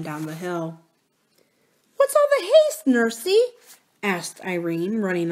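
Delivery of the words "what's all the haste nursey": put-on high, loud, curious little girl; child princess. A narrator's reading. Princess to her hurrying nurse, high childlike question.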